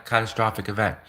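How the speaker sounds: ordinary talk, nothing marked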